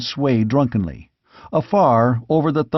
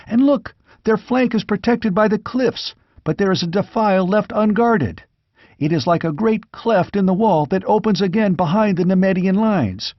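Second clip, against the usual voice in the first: put-on raised, eager young squire's voice reporting the fight; young squire watching battle; 7 semitones higher than usual. Squire reports the battle to the wounded king, excited young voice. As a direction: call out the battle like a breathless lookout.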